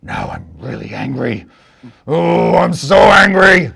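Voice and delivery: grumble, then bellow the anger; sobbing monster turned furious; hammed-up rage. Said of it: "he's like".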